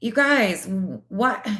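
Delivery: high, repeated stunned whats; shocked disbelief. Real, not acted. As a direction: react in utter disbelief.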